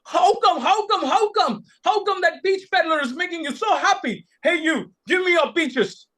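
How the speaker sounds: high, bewildered demanding lord; a baffled ruler blurting questions; lord, puzzled, demands the peaches, high and baffled; confused lord. A narrator's reading. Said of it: "Tonosuke was confused".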